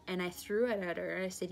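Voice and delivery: normal talking voice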